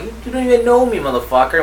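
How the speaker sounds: high-pitched retold protest; mock indignant retort. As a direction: protest in exaggerated disbelief. Retold retort to a threatening caller.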